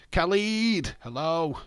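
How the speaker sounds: high, loud hail to a viewer; excited greeting. Real, not acted. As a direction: call out the name high, then ease down. Greets viewer joining the stream.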